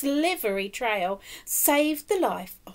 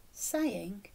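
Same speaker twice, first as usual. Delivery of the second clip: plain reading voice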